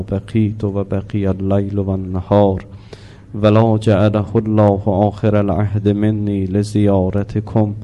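low, slow devotional recitation; ziyarat prayer recitation. Acted. Closing the lesson with prayer, slow low recitation. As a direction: intone the salutation slowly and reverently.

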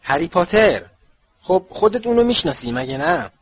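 hearty, booming introduction; booming loud. A narrator's reading. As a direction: boom it out to the visiting minister. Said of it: "با صدای بلند".

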